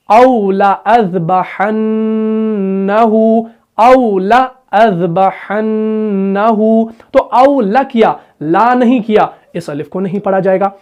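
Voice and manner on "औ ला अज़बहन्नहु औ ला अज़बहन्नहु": औ ला अज़बहन्नहु recited slowly, the alif after ला not pronounced; slow melodic recitation; correct recitation. Shown as a demonstration. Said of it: "औ ला नहीं होगा".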